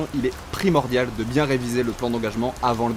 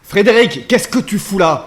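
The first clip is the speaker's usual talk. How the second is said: louder, higher, slower challenge; raised indignant voice; angry call out; 5 semitones higher, 6 dB louder, slower than usual. Acted. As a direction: shout up at the rival. Calling out to rival upstairs.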